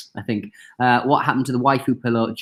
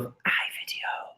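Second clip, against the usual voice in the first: whisper; 12 dB quieter, much slower than usual. Real, not acted. Whispered sponsor thanks.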